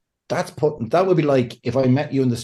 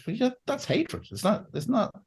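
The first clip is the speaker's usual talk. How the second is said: incredulous; 11 semitones higher, 6 dB quieter than usual. Retold or imitated. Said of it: "you're like".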